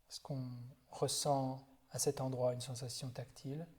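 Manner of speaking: calm, unhurried body-scan guidance with long silences; guided meditation. Real, not acted. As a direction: guide them gently through the body, leaving space after each phrase. (méditation guidée, voix calme et posée)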